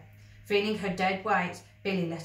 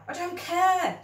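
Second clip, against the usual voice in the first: desperate; 12 semitones higher, 6 dB louder, slower than usual. Acted. Desperate boy.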